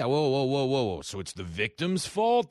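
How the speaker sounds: acted-out indignant protest; rapid string of whoas into an accusing question; mock objection. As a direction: imitate someone objecting in disbelief. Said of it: "you couldn't say".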